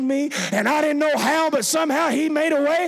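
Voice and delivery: high, loud shouted praise; preaching at full force. Real, not acted. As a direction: shout it out to rouse the congregation.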